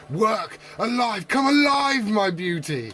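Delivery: rising to a high, loud, triumphant cry; hammed-up mad doctor bringing his creature to life; mad scientist Frankenstein. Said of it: "like Doctor Frankenstein".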